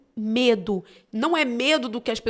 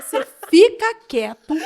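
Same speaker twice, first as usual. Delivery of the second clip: loud, high, slow order through amusement; mock scolding; 6 semitones higher, 11 dB louder than usual. Mother silencing her cheeky son, loud mock scolding. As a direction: tell him off loudly, drawing it out.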